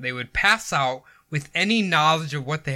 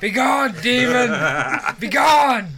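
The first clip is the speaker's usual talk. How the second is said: high, raised mock-exorcism cry; mock exorcist; 7 semitones higher, 4 dB louder than usual. Friend jokingly banishing demons with cooking oil, mock exorcist.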